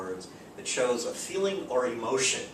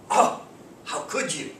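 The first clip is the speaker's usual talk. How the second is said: exclamation; 6 semitones higher, 5 dB louder than usual. Shown as a demonstration. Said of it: "you know, exclamation point".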